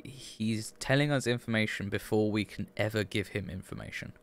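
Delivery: ordinary talk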